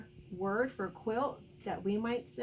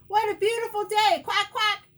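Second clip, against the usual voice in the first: loud, high-pitched duck holler; shouting duck; 11 semitones higher, 10 dB louder than usual. A narrator's reading. Noisy duck to quail in the park, shouting. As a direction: holler it like an excitable quacking duck. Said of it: "shouts Dottie".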